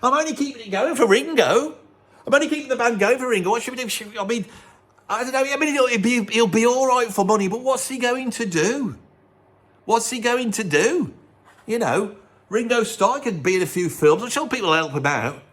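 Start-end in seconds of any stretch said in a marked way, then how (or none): 0.0-11.1 a worried, pleading higher voice. Retold or imitated. [name] panics to the band about keeping it going for [name].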